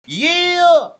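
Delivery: high, loud victorious whoop; triumphant yell. Retold or imitated. Kid shouting after his playground stunt, triumphant whoop. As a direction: cheer like a kid nailing a stunt.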